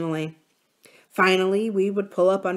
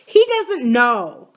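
put-on slow, louder, icy scorn from a small girl; frigid contempt; 5 semitones higher, 8 dB louder, much slower than usual. Mary telling her brother Tom is clueless, slow and icily contemptuous. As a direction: freeze him out with every word. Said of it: "said with frigid contempt".

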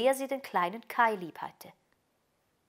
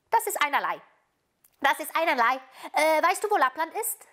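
put-on high, louder, insistent child voice; brisk robber girl; 8 semitones higher, 5 dB louder than usual. A narrator's reading. Robber girl questioning the reindeer about Lappland, brisk. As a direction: dismiss it and demand an answer.

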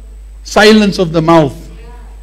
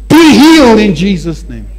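louder decree; bold command; 2 semitones higher, 5 dB louder than usual. Shown as a demonstration. Preacher showing how believers should command healing, bold. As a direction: declare it with authority. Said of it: "You must say".

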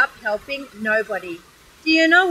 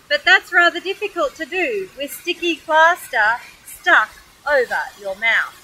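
ordinary delivery, nothing marked

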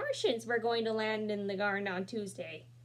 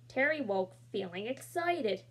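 usual speaking voice

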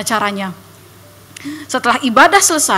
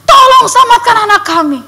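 loud, high pleading shout to Jesus; crying out in prayer; 11 semitones higher, 7 dB louder than usual. Retold or imitated. Congregation praying for the missing girl, loud high pleading shout. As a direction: cry out to God for a lost child. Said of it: "semuanya sedang begini".

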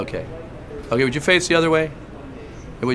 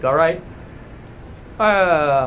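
ordinary delivery

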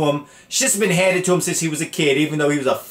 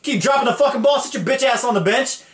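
a hint of an angry coach, pitched up; mock angry coach; 8 semitones higher than usual. Coach benching a fumbling player, mock angry yell. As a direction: bark at the player, half joking. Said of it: "pulled him out the game and says".

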